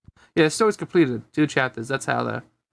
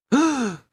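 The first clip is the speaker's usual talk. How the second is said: gasp; 10 semitones higher than usual. Acted. Gasp before characters speak.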